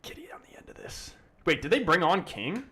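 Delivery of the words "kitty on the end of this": whisper. Real, not acted. Quiet murmur at the screen.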